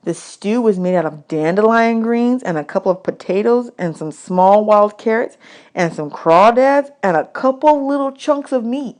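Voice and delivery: plain reading voice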